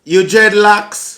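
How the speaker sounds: put-on loud, drawn-out Jamaican accent. Asking a passer-by about dreadlocks in a Jamaican accent. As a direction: ask slowly and loudly in Jamaican patois. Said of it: "You just did that accent".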